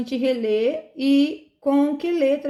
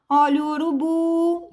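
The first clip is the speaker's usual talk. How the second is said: high, sustained cry, BU held long; street vendor's call; 6 semitones higher than usual. Shown as a demonstration. Teacher testing urubu with the selling trick, vendor's call. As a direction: hawk urubu with a long, level ending. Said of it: "é você vender a palavra".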